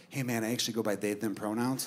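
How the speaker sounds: low, soft confidential aside; quiet interjection. Retold or imitated. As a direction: lean in and gently correct, keeping it down.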